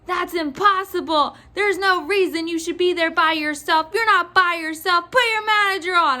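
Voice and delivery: imitated mad customer. Clerk mimics customer refusing to believe her, mad. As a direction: build to an irate mock demand. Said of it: "She was so mad".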